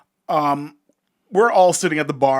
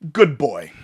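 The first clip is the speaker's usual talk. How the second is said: put-on condescending good boy; 3 semitones lower, 4 dB louder than usual. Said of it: "she goes".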